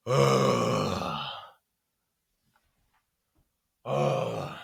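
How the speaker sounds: long put-upon sigh, then a breathy one; imitating a client's heavy sighs; exasperated sighs. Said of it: "He does the whole sigh".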